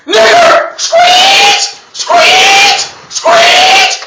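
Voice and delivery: screeched high and loud like a passing car; high mocking hollers; mock yelled taunt. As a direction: yell it shrill from a car window. Retold shouts from passing car.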